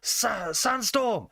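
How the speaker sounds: high shout across the tavern; shouted request. Acted. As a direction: shout the song title over the noise. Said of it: "shout over".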